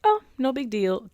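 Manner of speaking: dismissive attitude. Retold or imitated. Imitating father who can't receive things, mock dismissive. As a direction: toss it off as nothing much. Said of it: "type of attitude".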